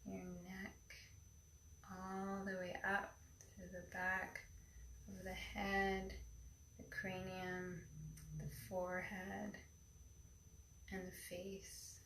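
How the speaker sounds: airy breathy mystical healer. Acted. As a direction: breathe each word into calm stillness. Leads a guided meditation.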